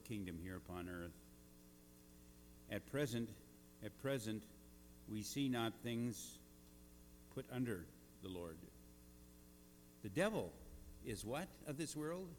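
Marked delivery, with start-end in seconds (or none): none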